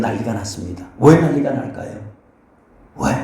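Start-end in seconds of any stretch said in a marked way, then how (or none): none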